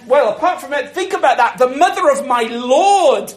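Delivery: normal talking voice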